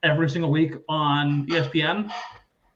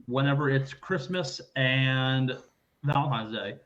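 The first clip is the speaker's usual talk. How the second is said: normal talking voice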